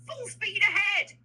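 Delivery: loud, high, drawn-out battle command; captain's yell. Acted. Captain ordering his ship into battle, loud high yell. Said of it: "We want the captain to yell".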